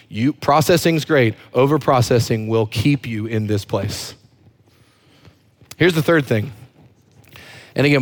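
usual speaking voice

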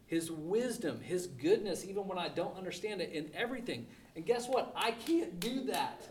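ordinary delivery

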